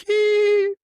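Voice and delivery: high-pitched loud squeal of a giggle; exaggerated squeaky giggle; mock giggle. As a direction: let out one shrill, fake hee. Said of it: "giggling in a boardroom".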